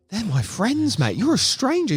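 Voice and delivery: high-pitched, affronted retort; indignant rebuke. Retold or imitated. Friend retelling how they corrected a stranger, indignant. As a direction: put him in his place, voice pitched well up. Retold telling-off of a rude stranger.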